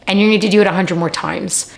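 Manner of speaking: ordinary talk, nothing marked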